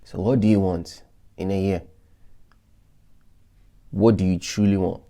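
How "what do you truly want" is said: ordinary talk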